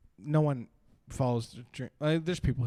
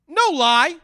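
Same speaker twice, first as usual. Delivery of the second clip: loud shout; 11 semitones higher, 10 dB louder than usual. Retold or imitated. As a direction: yell it out, high and loud. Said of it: "Literally said "no lie" at that volume".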